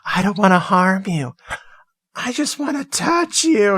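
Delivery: imitating the ghoul's worst, creepiest line; high, leering mock ghoul; creepy ghoul. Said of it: "he says in the worst way, it's so creepy".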